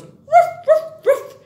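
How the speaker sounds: high, loud playful ruff ruff; a teacher barking like a dog for kids. Retold or imitated. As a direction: bark it out like a friendly puppy. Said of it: "Just like a dog goes".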